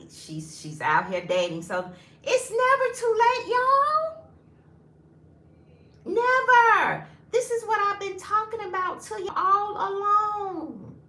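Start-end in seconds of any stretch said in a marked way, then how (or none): 2.2-9.2 high, loud, thrilled rallying cry to the audience; excited cheer. Real, not acted. Telling viewers dating has no age limit, high excited shout. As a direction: hype everyone up, it's never too late.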